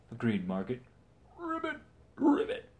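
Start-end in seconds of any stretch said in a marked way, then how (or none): 1.4-2.7 a much higher, croaky little ribbit; toad croak. Acted. Frog the Toad answering the knight with a ribbit. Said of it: "replied Frog the Toad".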